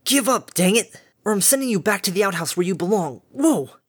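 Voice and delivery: high, loud yell through effort; straining angry yell. Acted. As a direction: yell while straining to hold it down. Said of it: "spit and hissed through gnashing teeth".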